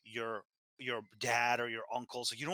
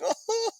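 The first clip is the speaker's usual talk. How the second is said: very high, loud imitated wail; hammed-up high sob; mock sobbing; 6 dB louder than usual. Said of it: "he started sobbing".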